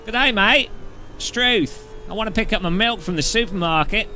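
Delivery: mock a bad Australian caricature; cartoon Australian accent, overdone and cheerful. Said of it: "my Australian accent's not very good".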